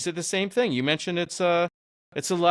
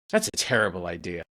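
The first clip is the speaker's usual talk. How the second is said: lower, calm imitation of the rabbi; rabbi's way of talking; 8 semitones lower than usual. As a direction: lightly mimic the rabbi's calm delivery. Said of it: "This is how he talks".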